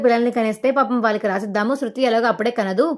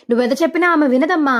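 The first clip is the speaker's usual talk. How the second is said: a raised, higher outburst that cuts him off; shouting; 5 semitones higher, 3 dB louder than usual. A narrator's reading. Grandmother shouting at Balu to drop it, a narrated raised outburst. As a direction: snap it out to stop the argument. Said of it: "Sugunamma గట్టిగా అరుస్తుంది".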